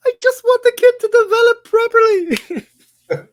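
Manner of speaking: very high, loud, laughing silly voice; high laughing put-on voice. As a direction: squeal it through laughter. Mock voice for a clip.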